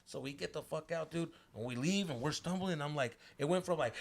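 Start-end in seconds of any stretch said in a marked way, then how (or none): none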